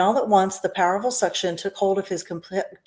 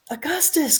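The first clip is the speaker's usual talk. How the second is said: mild call; 9 semitones higher than usual. A narrator's reading. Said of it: "called out Mister Gloop".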